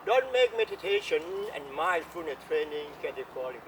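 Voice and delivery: ordinary talk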